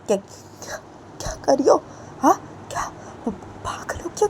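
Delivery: whispered exasperation. Acted. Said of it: "तो बानी बोलती है".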